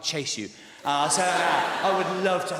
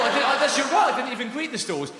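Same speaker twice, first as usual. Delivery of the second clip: flustered protest; 9 semitones higher, 6 dB louder than usual. Real, not acted. Flustered reply to audience heckle.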